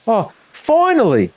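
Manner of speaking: high, drawn-out burst of relief; exasperated relief. Real, not acted. Streamer who can start playing at last, exasperated relief. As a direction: let it out after a long frustrating wait. Setup finally works after delays.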